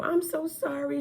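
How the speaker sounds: high, lightly voiced apologetic social worker; imitating social worker. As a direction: pitch her apology way up. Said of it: "she basically was like".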